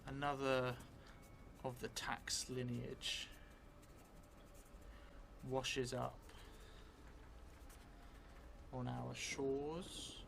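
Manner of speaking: slow, drawn-out in-character telling, often hushed; slow story narration. Acted. Vampire player dictating a new memory to the audience, slow hushed narration. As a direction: spin the vampire's memory word by word.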